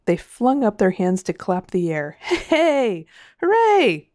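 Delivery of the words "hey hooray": high-pitched celebratory cheer; big, rising hooray; crowd cheering. A narrator's reading. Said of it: "said the crowd".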